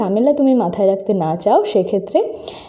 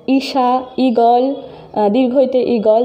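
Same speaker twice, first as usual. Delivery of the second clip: slow; much slower than usual. Shown as a demonstration. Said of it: "দেখো".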